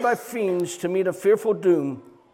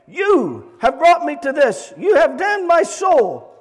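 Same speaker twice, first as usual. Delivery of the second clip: higher, louder, slow accusation; accusing cry; 7 semitones higher, 5 dB louder than usual. Retold or imitated. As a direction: cry out the blame. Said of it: "cried as he fixed his glaring eyes".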